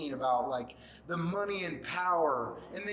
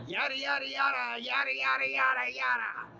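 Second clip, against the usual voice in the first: shouted mock babble; mocking yada yada; 8 semitones higher than usual. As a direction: sneer the yadas like a parrot. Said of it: "you're there like".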